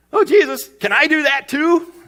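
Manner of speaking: high, loud, hammed-up request; eager disciple. Peter asking Jesus to join him on the water, eagerly. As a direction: beg like an overeager pupil. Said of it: "And so Peter, he begins to get it".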